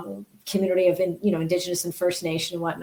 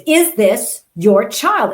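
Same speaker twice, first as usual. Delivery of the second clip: louder and slow, pressing each word; demanding question; 4 semitones higher, 8 dB louder, much slower than usual. Retold or imitated. Security agent pressing the mother for an answer, loud and slow. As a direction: lean on the mother like a stern guard. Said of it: "he goes".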